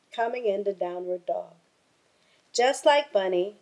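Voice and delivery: plain reading voice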